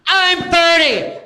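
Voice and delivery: high, loud, drawn-out confession; mock anguished shout. Comic telling the young twinks his age, high drawn-out shout. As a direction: blurt it out in a long comic yell.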